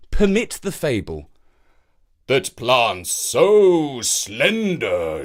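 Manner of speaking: lightly voiced oak, slow and musing; a hint of a stubborn old tree thinking aloud; proud old oak. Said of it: "thought the oak".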